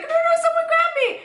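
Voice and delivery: hammed-up cry for help; mock panic. Pretending to panic on a steep hill, squealed plea.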